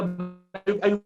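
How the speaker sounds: ordinary talk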